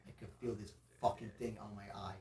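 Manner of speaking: low, hushed grumble; retold complaint, kept under the breath; quiet complaint. Telling friends about the eye on the paddle back, quiet complaint.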